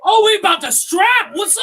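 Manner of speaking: loud, high mock shout; retold battle cry. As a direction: act fired up for a fight. Retells being ready to fight for relative.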